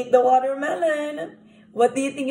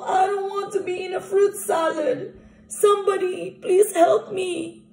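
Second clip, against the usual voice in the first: higher, pleading call for help; cry for help; 7 semitones higher than usual. Acted. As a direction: beg for rescue, pitched up. Crocodile cries for help.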